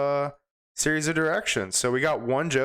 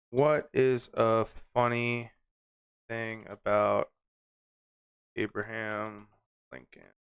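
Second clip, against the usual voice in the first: typing aloud; 3 semitones lower, slower than usual. Real, not acted. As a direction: say it slowly as you type, trailing away. Types the question while saying it.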